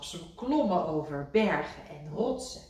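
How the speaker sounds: usual speaking voice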